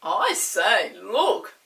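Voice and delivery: loud amazed call; amazed Peter. Acted. Peter seeing the castle on a cloud, loud and amazed. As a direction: point it out in wonder. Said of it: "said Peter in amazement".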